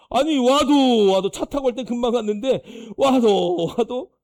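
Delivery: fuming frustration. Retold or imitated. Pastor recalling the walk home, loud exasperated complaint. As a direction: stretch each word like you can't believe the distance.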